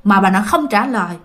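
normal talking voice

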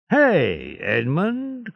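high call across the street; hey called high, name rising. A narrator's reading. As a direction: hail a passing friend. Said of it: "cried Caderousse".

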